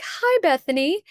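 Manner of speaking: high, sing-song hello; kindly counselor greeting. Retold or imitated. Kind counselor welcoming a student, high friendly greeting. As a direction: imitate a kind lady's high-pitched welcome.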